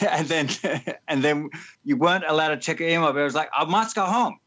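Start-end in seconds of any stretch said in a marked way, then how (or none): 3.5-4.4 teasing South African accent impression. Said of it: "in your South African accent".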